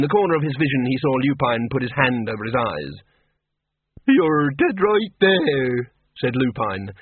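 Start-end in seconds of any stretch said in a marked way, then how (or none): 4.1-5.9 slow, dry deadpan pun. A narrator's reading. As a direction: say it slowly with a straight face. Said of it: "his face absolutely straight".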